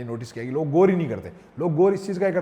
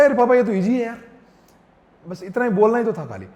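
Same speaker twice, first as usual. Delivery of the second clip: higher, louder, thrilled to tell papa; excited kid; 6 semitones higher, 7 dB louder than usual. Retold or imitated. Son telling papa it was easy, excitedly. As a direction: burst out with relief that it was easy. Son relieved after making friends.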